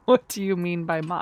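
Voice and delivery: usual speaking voice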